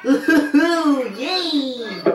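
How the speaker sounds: put-on high, goofy kids'-show character cheer; Barney voice. Player brought into the story as Barney, high character voice. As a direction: squeal it like a giggly cartoon dinosaur. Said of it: "[name] does his Barney voice".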